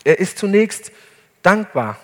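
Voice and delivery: ordinary delivery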